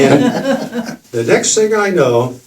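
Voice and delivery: chuckling through the words; a laugh in the voice as he begins. Real, not acted. Telling the room the next bit, laughing.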